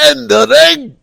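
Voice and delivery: "in the ring" blasted out high and loud; odd emphasis. Acted. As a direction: hit "in the ring" with absurd, shouted emphasis. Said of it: "why'd you put emphasis on those words".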